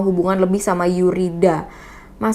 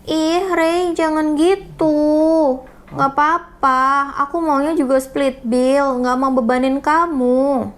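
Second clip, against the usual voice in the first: put-on higher, sweet girlfriend voice; girly voice; 7 semitones higher than usual. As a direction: read her chat in a high girly voice. Reads girlfriend's chat messages.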